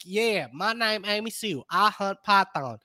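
mild Southern local twang; Amy Sue. Acted. Host shows how born Floridians talk, Southern twang. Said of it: "the people who are from there".

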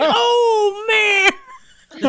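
high, drawn-out mock wail; retold long, high groan of dismay; imitated dismay. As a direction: wail it out like someone mortified. Retelling his dismayed reaction.

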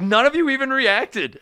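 high, loud, drawn-out disbelief; retold astonishment, pitched right up; incredulous. Comic on stage to crowd that ignored an arrest, incredulous.

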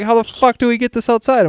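retold panic with a hint of a yell; half-hearted mock exasperation; exasperated quote. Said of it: "So we're like".

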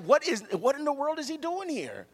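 high-pitched indignant outburst as big brother; acted furious. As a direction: play an older brother furious at the intrusion. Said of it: "he was angry and he was furious".

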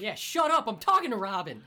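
high, loud cut-off yell; mock angry shout. Hero shuts up the villain, acted angry shout. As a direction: shout down someone who interrupts.

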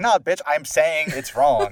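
loud, slow, drawn-out comeback; mock insistent retort. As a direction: act out an emphatic argument, half laughing. Retold argument, insisting.

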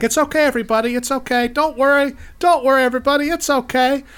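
high, loud forced-calm call to the crowd; calling out reassurance. Acted. Park worker calling out to the crowd, loud and forced calm. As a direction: shout "don't worry" to panicking guests, hiding terror.

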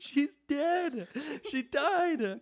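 high giggly breaking-up voice; can barely get it out for laughing. Real, not acted. Storyteller cracking up explaining the dead mother.

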